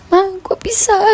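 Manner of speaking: high, startled ma kok bisa; shocked question. Retold or imitated. Brother hears she was beaten, high startled disbelief. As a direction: pitch jumps up in disbelief. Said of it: "Abang saya sentak kaget".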